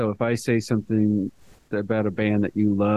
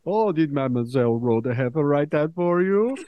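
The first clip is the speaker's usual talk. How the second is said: higher-pitched imitation of a French teacher; teasing French teacher; 4 semitones higher than usual. French teacher to student about a sister's help, teasing imitation. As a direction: put on a teacher's knowing suspicion. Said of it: "My French teacher".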